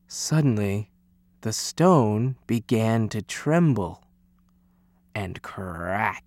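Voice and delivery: slow down and drop the voice for suspense; hushed suspense. A narrator's reading. Narrator before the birthday stone hatches, hushed suspense.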